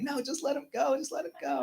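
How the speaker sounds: high-pitched mock plea; pleading wife. Wife to husband about scared son, imitated high plea. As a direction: imitate a mother begging to let her child off. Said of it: "my wife's like".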